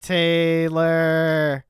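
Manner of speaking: creepy repeated call swelling to a loud cry; eerie name call. Retold or imitated. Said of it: "I'm in her ear".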